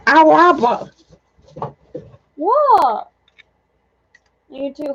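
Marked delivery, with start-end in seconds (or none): 0.1-1.7 mock cat cry. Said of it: "He said".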